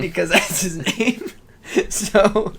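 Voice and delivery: slow, high words broken by laughter; can't stop laughing while explaining. Real, not acted. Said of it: "Why are you laughing?".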